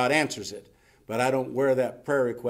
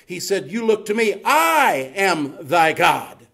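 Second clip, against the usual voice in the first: loud, raised voice with long-held key words; full-force preaching; 6 semitones higher, 7 dB louder than usual. Acted. Preacher voicing God's command to look to him alone, thunderous. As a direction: proclaim it to the whole congregation.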